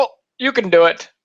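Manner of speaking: mock easy approval from the author; imitated approving author. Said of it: "And he was like".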